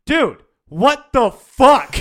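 high, loud, slow shocked yell; imitating the sergeant's stunned outburst. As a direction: drag out the disbelief.

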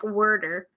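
"worder" with an er vowel in place of the a; mimicking his pronunciation. Teasing partner about his accent, "worder" with an er sound. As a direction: imitate his water as "worder", like word plus er. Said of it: "he says it like worder".

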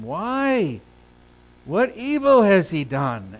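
high, loud, disbelieving question; drawn-out, incredulous appeal; Pilate protesting. Acted. Reads Pilate's protest to crowd.

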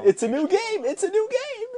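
high, excited game show chant; excited sing-song. Acted. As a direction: hype up the new game. Hyping the new game.

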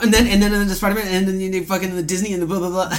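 high, loud, excited babbling; rambling on tangents. Acted. Imagining the hosts going off on tangents, excited rambling. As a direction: stumble over yourself in mock excitement. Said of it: "Think about when we go off on tangents".

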